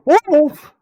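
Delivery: high startled scream. A narrator's reading. Teen boy sees a wolf and screams. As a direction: scream it in fright. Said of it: "and screamed".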